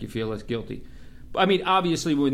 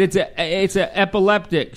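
louder, higher insistent radio correction; exasperated correction; 6 semitones higher, 4 dB louder than usual. Retold or imitated. Cop correcting dispatcher over radio.

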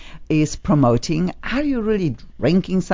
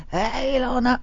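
lightly imitated raspy greeting, pitched higher; mimicking raspy voice; 8 semitones higher, 4 dB quieter than usual. Host playfully copies the boy's raspy hello, lightly done. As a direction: echo the boy's raspy hello back at him. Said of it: "I love your raspy voice".

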